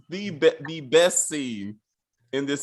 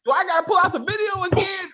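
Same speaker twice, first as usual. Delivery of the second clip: mock whine.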